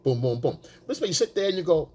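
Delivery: ordinary delivery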